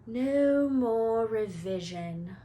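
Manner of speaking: slow, drawn-out contented sigh; sighed happily. A narrator's reading. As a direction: let it out slowly, blissfully relieved. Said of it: "Ron sighed happily".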